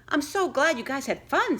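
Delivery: put-on high, loud girly voice; gushing girl. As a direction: gush like a party host.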